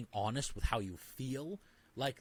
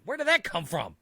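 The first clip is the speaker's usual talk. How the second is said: much louder and far higher, near a mock shriek; shocked disbelief; 13 semitones higher, 12 dB louder, faster than usual. Realizing the baggage was always there, loud shocked squeal. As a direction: sell total astonishment. Acting out sudden self-realization.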